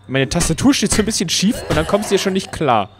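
ordinary talk, nothing marked

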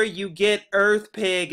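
normal talking voice

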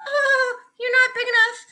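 very high, timid excuse; nervous backing down. A narrator's reading. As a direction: squeak your way out of the fight. Grouchy ladybug backing down from lobster.

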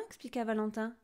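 ordinary delivery, nothing marked

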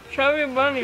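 mumbling through stuffed cheeks; cheeks stuffed full. Real, not acted. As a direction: mouth full of marshmallows. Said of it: "Oh, I have to say it?".

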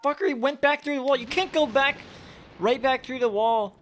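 high indignant complaint; frustrated yelling. Real, not acted. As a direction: protest at the cheating ghost. Yelling at ghost escaping.

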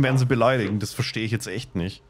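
ordinary delivery

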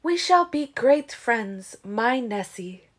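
declaring wild woman. Acted. Wild woman Zafrina declaring friendship to Nessie, slow and grand. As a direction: proclaim it grandly and slowly. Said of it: "the wild woman had declared".

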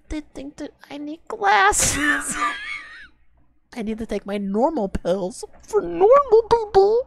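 high voice breaking into laughter; mock the line while cracking up; laughing through words. Real, not acted. Streamer laughs while mocking the song's lyric.

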